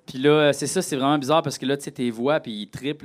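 ordinary talk

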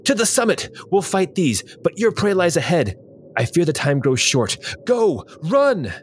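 high, urgent warrior's call at moderate volume; narrated battle shout. A narrator's reading. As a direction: voice a fighter sending allies onward. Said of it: "shouted the tall".

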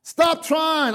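ordinary talk

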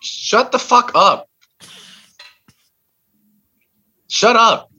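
high, loud, exasperated shout; fed-up yell; angry outburst. Acted. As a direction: shut them down, drawing out each word. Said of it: "I'm getting really angry".

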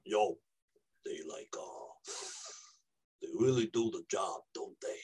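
low, hushed New Yorker yoga teacher; New York accent. Acted. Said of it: "you're a New Yorker who's a yoga teacher".

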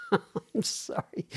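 cracking up, words lost in laughter; helpless laughing apology. Real, not acted. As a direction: break down laughing mid-apology. Cracks up at own bad accent.

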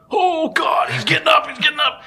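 high, loud panicked yelp; frantic, voice cracking up high. Retold or imitated. Panicked thoughts as buck stands.

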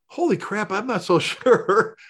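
half-laughing shock at a close call; slow amused holy crap; laughing disbelief. Retold or imitated. Retelling his shock to dad.

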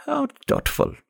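put-on very high, gravelly old woman, sarcastic; high-pitched, sarcastic old crone. A narrator's reading. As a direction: sneer it as a scornful old woman. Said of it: "The old woman's gravelly voice".

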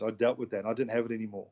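usual speaking voice

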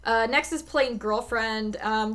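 ordinary delivery

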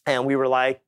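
ordinary delivery

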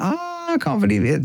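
shocked at what you just did; regretful disbelief. Retold or imitated. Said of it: "And we're like".

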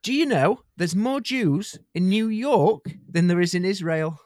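hammed-up New York patter; New York accent. Shown as a demonstration. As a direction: announce it as a caricature New Yorker. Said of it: "what do people from New York speak like?".

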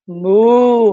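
long, loud moo; cow moo. Shown as a demonstration. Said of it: "¿cómo lo hace la vaca?". (mugido de vaca fuerte)

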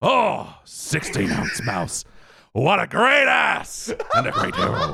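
hammed-up movie-star outburst; Al Pacino. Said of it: "Al Pacino?".

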